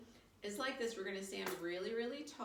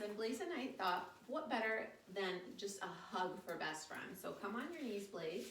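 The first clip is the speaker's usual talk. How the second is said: ordinary talk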